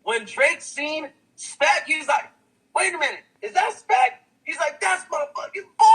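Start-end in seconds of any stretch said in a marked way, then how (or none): 2.8-4.2 imitated surprised recognition, pitched up. Rapper spotting his friend in a crowd, surprised. 4.8-5.9 imitated excited shout-out, high-pitched. Rapper recognising his buddy, excited.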